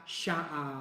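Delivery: lightly imitated Essex accent; mimicked Essex accent. Husband shows how wife's accent gives her away, mock Essex. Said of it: "you can tell with her, she's like".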